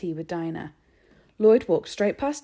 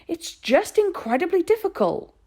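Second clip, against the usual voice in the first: shouted; 12 semitones higher, 7 dB louder than usual. A narrator's reading. Boy shouting at his mother over the sums, loud. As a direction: yell the correction. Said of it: "Harvey shouted".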